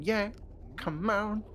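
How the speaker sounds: high, light pop star impression; a hint of a pop singer's ad-lib; [name]. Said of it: "He went".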